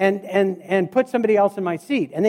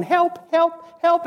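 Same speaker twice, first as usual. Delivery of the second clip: mock cry for help; 7 semitones higher, slower than usual. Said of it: "I'm going".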